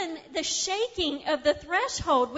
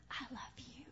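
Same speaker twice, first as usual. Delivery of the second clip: breathy whisper, tender and close; whispered love; 3 semitones lower, 22 dB quieter than usual. Acted. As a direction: whisper it as God would. Voicing God's tender words.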